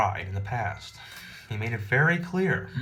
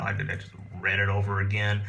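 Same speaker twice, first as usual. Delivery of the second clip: ordinary talk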